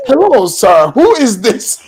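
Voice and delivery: laughing retelling. Retold or imitated. Retold reply amid own laughter.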